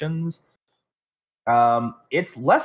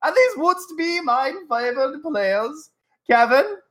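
pompous olde-English showman, high and loud; hammy stage actor; 14 semitones higher, 3 dB louder than usual. Acted. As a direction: ham it up like a bad Shakespearean actor. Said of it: "I was making fun of Gavril".

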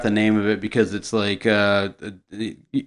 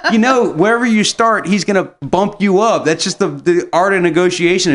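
animated rant; 6 semitones higher than usual. Real, not acted. Laughing aftermath explaining negotiation.

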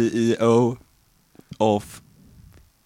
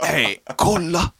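imitating Fredrik; 7 semitones higher, slower than usual. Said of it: "Och så hör jag".

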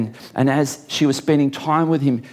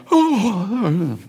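mock yawn; 7 semitones higher, slower than usual. Preacher imitating a yawning listener, mock yawn. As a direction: act out an exaggerated yawn. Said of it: "you might be yawning, you might be going".

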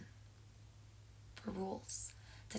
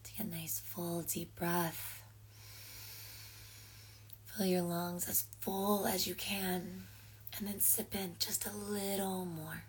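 guided breathing. Acted. Leads slow breathing exercise.

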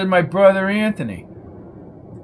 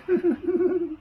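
high falling titter; squeaky giggle; 6 dB quieter than usual. A narrator's reading. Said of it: "Flo giggled".

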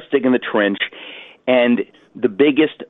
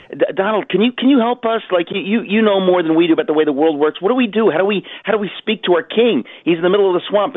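high, anxious villager voice; pleading cave people; 6 semitones higher than usual. Retold or imitated. Cave people beg Donald for help, in a high, anxious voice. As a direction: beg the wise builder for help. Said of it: "they go to Donald and they say".

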